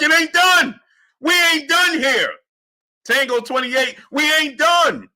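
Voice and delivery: rally the viewers, we ain't done; hype shout. Real, not acted. Hyping the chat.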